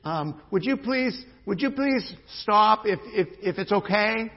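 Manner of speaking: high, slow, sugary mock-pleading; high, slow, over-sweet plea; timid pleading parent. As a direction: mimic a parent who asks too nicely. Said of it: "That mother didn't say".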